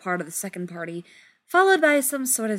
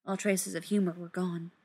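usual speaking voice

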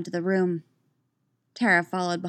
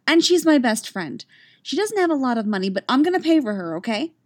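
higher-pitched, quick plea for a friend; insistent girl; 6 semitones higher, 4 dB louder, faster than usual. A narrator's reading. Girl offers to pay for her best friend, insistent. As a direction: speak up fast for your best friend.

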